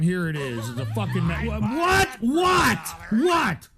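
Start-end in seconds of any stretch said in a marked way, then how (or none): 1.4-3.7 high, loud repeated what; shocked disbelief. Real, not acted. Streamer outraged by a donation, shouting what in disbelief.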